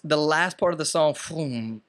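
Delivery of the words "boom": usual speaking voice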